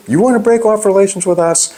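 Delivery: incredulous challenge. Pretend. Rebuking China's leaders over breaking off relations, incredulous and fast. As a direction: scoff at them like the idea is absurd.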